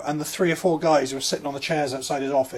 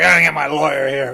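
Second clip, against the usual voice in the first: threatening dad; 8 dB louder than usual. Retold or imitated. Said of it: "He's like".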